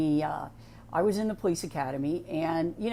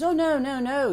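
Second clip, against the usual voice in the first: high, louder mock scold; retold instructor, pitched high and a bit louder; 8 semitones higher, 4 dB louder than usual. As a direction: imitate the instructor waving her off. Instructor refuses her push-ups.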